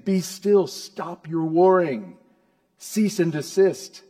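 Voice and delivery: loud, high, forceful orders; commanding. Acted. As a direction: call out sharply to halt warring nations. God commanding the warring nations.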